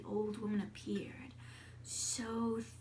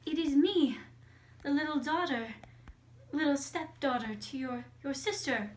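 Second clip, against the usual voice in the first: put-on higher, louder, earnest child's voice; little girl calling; 4 semitones higher, 6 dB louder than usual. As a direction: call up to someone behind a door. Girl calls to the closed door.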